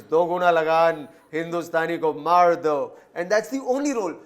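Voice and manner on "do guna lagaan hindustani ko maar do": loud mock film-villain order.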